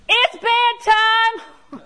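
high, slow, stretched-out loud call. Retold or imitated. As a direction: draw it out high and loud so she hears. Waking the deaf old dog.

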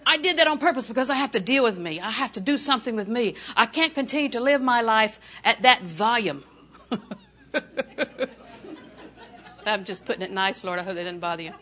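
ordinary talk

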